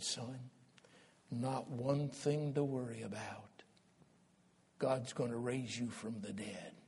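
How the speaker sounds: soft, gentle fatherly voice; calm a frightened son; tender reassurance. Acted. The father leans over Isaac to calm him: soft and tender.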